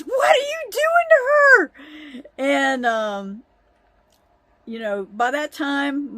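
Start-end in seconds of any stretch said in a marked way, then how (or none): 0.0-1.7 much higher, loud, crying shout; mom's shout. Retold or imitated. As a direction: scream at grandpa to protect her. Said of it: "She goes".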